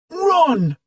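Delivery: high, loud urgent shout; a boy yelling at his friends to run. A narrator's reading. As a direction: yell at them to flee. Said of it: "shouted D J".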